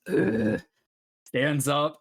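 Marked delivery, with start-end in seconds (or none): 0.1-0.6 short, louder grunt of effort; orc grunt. Acted. Orc getting up to shove past the passengers, grunting. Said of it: "this orc figure kinda goes".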